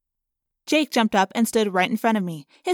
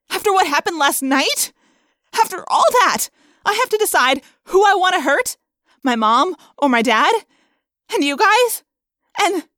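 distraught, high, raised questions; yelling; 9 semitones higher, 7 dB louder than usual. A narrator's reading. Rachel torn between parents and friends, yelling. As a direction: pour it out loudly, near breaking point. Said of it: "I yelled".

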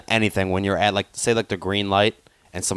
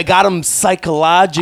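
high, louder gloating; pitched-up self-satisfied gloat; smug triumph; 8 semitones higher, 4 dB louder than usual. Retold or imitated. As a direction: crow it proudly. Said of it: "you're like".

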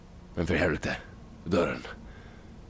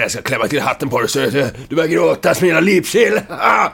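threatening; 6 semitones higher, 4 dB louder than usual. Acted. Threatening an enemy.

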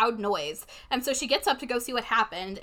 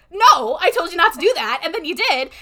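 loud, higher-pitched scolding; retold upset protest; 5 semitones higher, 8 dB louder than usual. Girl to her date after the donuts scare, upset protest. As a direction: snap back in loud reproach.

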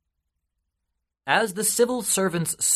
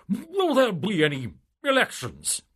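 pompous official; 10 semitones higher than usual. Acted. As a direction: puff up like a smug politician. Civil servant asks eagerly.

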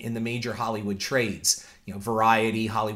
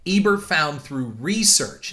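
"research" drawn out slowly and leaned on; slow down and hit "research" hard; stress on research; 2 semitones higher, 3 dB louder than usual. Shown as a demonstration. Host stressing Ebert's research to viewers, slow emphatic "research". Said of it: "I'm emphasizing research".

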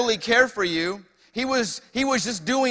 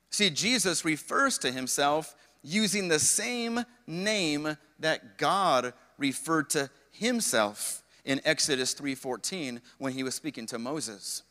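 ordinary talk

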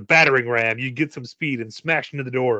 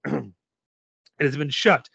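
normal talking voice

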